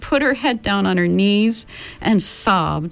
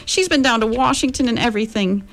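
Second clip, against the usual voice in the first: higher voice, fighting back a mild sob; a girl holding back tears as she talks; speaking over a sob; 9 semitones higher than usual. Acted. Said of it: "Meg choked down a sob, managing to speak over it".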